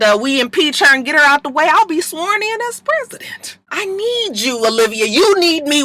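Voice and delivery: high, pushy hammed-up schemer; scheming Cyrus. Cyrus pushing Olivia to impeach the president, hammed up high. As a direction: pitch it high and greedy like a con man. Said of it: "He was like".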